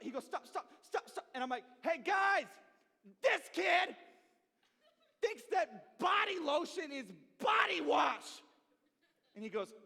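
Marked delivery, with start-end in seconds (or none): 0.2-1.3 1 imitating brother. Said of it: "We're imitating my brother". 1.8-8.4 loud, high, slow announcement; calling out to the dorm to embarrass his brother, loud; calling out to the room. Retold or imitated.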